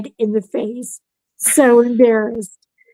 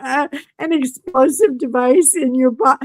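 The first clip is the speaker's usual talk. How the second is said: higher mock disbelief; 5 semitones higher than usual. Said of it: "He's like".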